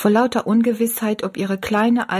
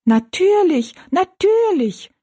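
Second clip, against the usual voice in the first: very high, louder little-person voice; dwarfs echoing; 12 semitones higher, 5 dB louder, slower than usual. A narrator's reading. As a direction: pipe it up high, stretching the repeat. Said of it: "wiederholten die drei Zwergenmenschen". (hohe, laute Zwergenstimme, gedehnt)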